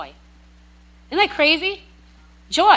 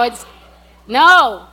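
ordinary delivery

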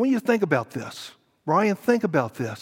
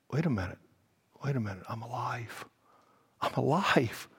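low, very soft stunned murmur; hushed realisation; 6 semitones lower, 10 dB quieter than usual. Acted. Firstborn Brian realising he survived, hushed. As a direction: barely voice it as it dawns on you. Acting boy's dawning realisation.

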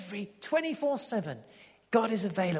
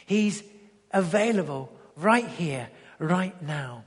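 ordinary talk

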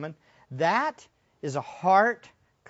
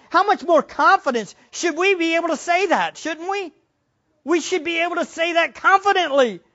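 higher, urgent preaching push; fervent exhortation; 8 semitones higher than usual. Real, not acted. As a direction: lift and drive the challenge.

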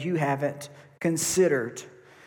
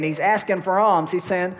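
ordinary talk

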